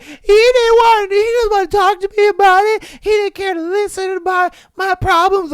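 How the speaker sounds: high, crying mock girlfriend voice, slowed and loud; mock-sob like a hurt girlfriend complaining; whiny girlfriend. Imitating a girlfriend who feels ignored, high and mock crying. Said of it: "they're just like".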